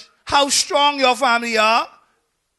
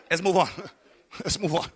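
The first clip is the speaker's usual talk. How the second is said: low, soft words through a chuckle; chuckling; 6 semitones lower, 9 dB quieter, faster than usual. Real, not acted. Preacher moving on after his own joke, chuckling. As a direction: try to move on while laughing.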